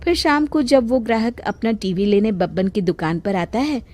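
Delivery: ordinary delivery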